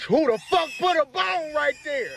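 outraged. Acted. Client outraged at bone.

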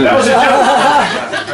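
laughing admission. Real, not acted. Admitting a joke, laughing.

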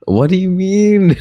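very high, loud squawk of protest; incredulous high-pitched yell; indignant yelp. Real, not acted. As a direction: shoot way up high in disbelief. Prank caller protests being dismissed.

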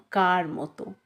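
plain reading voice

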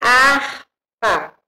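ordinary talk, nothing marked

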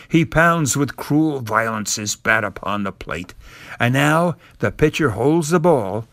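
ordinary delivery, nothing marked

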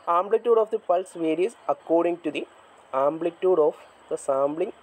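slow dictation. Shown as a demonstration. Teacher dictating a definition to the class, slow and measured. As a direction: dictate the definition word by word, very slowly.

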